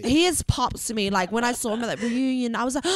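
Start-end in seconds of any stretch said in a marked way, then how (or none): none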